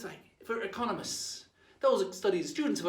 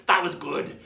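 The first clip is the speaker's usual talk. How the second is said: usual speaking voice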